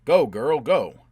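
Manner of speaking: loud, urgent command to flee; urgent shout. A narrator's reading. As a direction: yell her into moving. Urging her to flee the raid.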